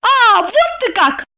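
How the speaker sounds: high, drawn-out indignant attack; pouncing. A narrator's reading. Infuriated Evdokia attacks her friend, high and indignant. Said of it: "набросилась на неё Евдокия".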